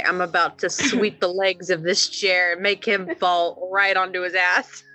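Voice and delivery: ordinary talk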